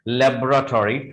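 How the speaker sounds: laboratory said as "LEB-ra-tori"; full "tori" on the end of laboratory; British pronunciation. Shown as a demonstration. Teacher repeating the first form, labelled British. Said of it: "इसका British pronunciation हो गया".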